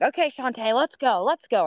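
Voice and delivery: loud encouraging cheer; cheering her on. Retold or imitated. Runner cheering her friend up the hill. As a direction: pump her up to sprint. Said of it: "cheering her on the hill".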